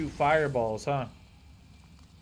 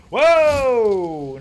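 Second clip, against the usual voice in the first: startled shout; 7 semitones higher, 10 dB louder than usual. Real, not acted. Startled by attack.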